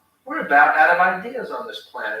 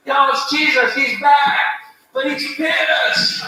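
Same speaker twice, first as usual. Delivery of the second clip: excited announcement; 8 semitones higher than usual. Acted. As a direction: warn everyone like a panicked witness. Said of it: "excited announcement of Jesus' return".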